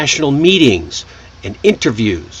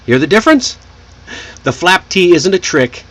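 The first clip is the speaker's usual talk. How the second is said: ordinary talk, nothing marked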